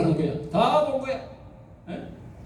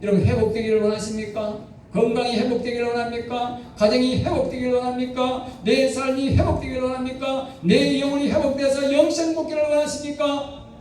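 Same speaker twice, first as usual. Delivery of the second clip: rising rhetorical questions, building higher; preaching; 6 semitones higher than usual. Acted. Preacher rousing the congregation with rising questions. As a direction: preach it, climbing with each question.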